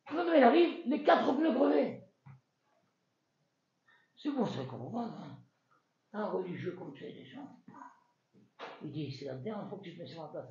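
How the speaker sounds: ordinary talk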